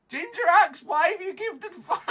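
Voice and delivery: loud high wail with laughter; laugh-scream in helpless despair; words stretched into high, hysterical yells; hysterical shrieking. Real, not acted. Hysterical at gifts during failing stream.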